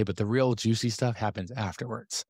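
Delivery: ordinary talk, nothing marked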